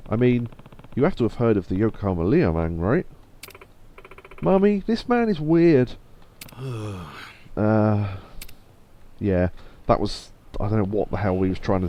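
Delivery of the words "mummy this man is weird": put-on high, slow little-kid voice; child voice. Child tells mummy about a weird man, high child voice. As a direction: pitch it up like a child telling mummy.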